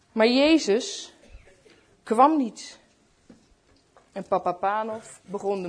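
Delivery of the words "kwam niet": ordinary delivery, nothing marked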